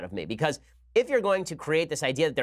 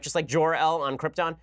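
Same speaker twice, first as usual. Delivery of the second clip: ordinary delivery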